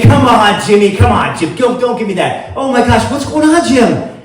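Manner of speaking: loud, high, disbelieving buddy voice; boisterous insistence. Acted. Giant guy refuses to believe him, boisterous insistence. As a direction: badger him like an old pal.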